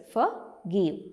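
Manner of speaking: "forgive" split into two beats; syllable by syllable. Shown as a demonstration. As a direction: say "forgive" as for, pause, give. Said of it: "There are two syllables".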